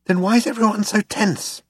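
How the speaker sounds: high, anxious question; tense. A narrator's reading. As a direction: ask it high-pitched and worried. Anxious companion questions Zaphod.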